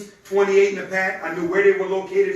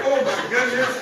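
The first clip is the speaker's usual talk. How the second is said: higher mock-amused exclamation; imitating laughing arrivals; 5 semitones higher than usual. Teasing ladies who just walked in, imitating their laughter. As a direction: playfully mimic giggling latecomers. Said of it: "They came in laughing like".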